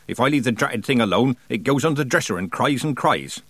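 plain reading voice

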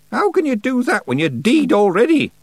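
sharp, high, loud challenge; old witch sharply challenging a boast. A narrator's reading. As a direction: cut in with a pointed question. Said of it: "said Miss Treason sharply".

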